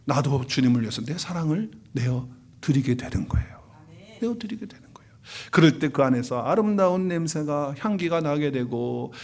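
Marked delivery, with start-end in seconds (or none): 4.2-5.0 whispered echo of the line. Acted. Pastor repeats the point to the congregation in a whisper. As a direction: breathe it softly to let it sink in.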